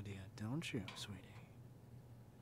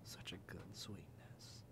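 much softer, slow whispered "good sweetness"; 4 semitones lower, 9 dB quieter, slower than usual. Acted. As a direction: breathe the praise almost silently. Soft praise after feeding.

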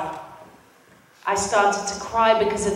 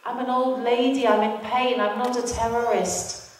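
retold crying plea; 6 dB quieter than usual. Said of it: "She was screaming in Arabic".

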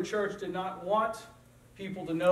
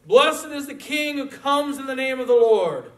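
raised, ringing proclamation; loud praise; 9 semitones higher, 12 dB louder than usual. Acted. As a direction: proclaim it like a joyful crowd. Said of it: "praise God in loud voices".

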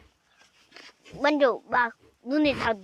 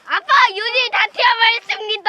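excited announcing; 9 semitones higher, 8 dB louder than usual. Real, not acted. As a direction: shout the news to viewers. Kid announces to viewers.

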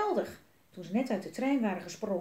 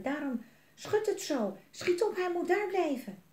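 put-on higher, urgent little girl; 9 semitones higher than usual. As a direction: push him to hurry. Elise waarschuwt op de tak. (Elise spoort Jelmer hoog en dringend aan)

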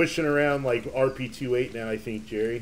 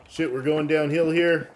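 usual speaking voice

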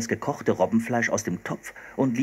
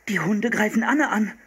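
alarmed thought; 11 semitones higher, 4 dB louder than usual. Acted. Philipp fears dogs attack.

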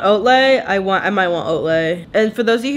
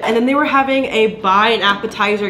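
normal talking voice

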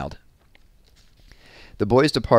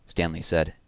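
usual speaking voice

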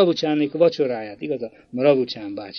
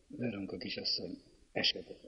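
much softer, hesitant asking; quiet, timid plea; 6 semitones lower, 16 dB quieter than usual. A narrator's reading. Young man timidly asking the girl for her hand, quietly. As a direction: ask shyly, barely above a breath. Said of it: "csendes, bátortalan hangon kérte".

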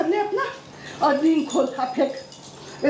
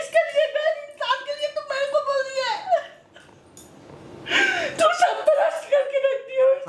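higher, tearful wail through sobs; mother wailing at her daughter, higher and tearful; staged crying; 7 semitones higher than usual. Acted. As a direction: cry it out like a betrayed mother. Mother breaks down over daughter's marriage.

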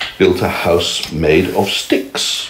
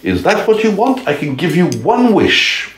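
very high, airy fairy voice; a kind fairy offering a wish, pitched way up; 10 semitones higher than usual. A narrator's reading. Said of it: "a fairy appeared and said".

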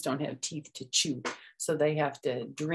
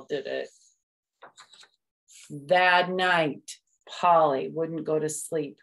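usual speaking voice

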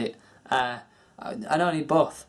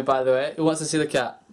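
ordinary delivery

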